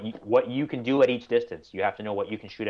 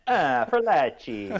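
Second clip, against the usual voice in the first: slow Italian accent on "Furlacci"; "Ah, Furlacci" rolled in Italian accent; 4 semitones higher, 3 dB louder, much slower than usual. Shown as a demonstration. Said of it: "you gotta do an Italian accent".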